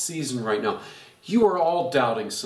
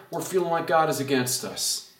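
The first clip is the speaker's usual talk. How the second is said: ordinary delivery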